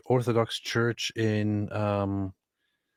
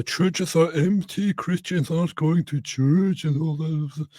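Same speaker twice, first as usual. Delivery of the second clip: slow, higher mocking tone; 6 semitones higher, slower than usual. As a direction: mimic the doom-sayers, slow and high. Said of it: "I heard a lot about".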